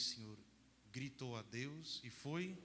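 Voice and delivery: normal talking voice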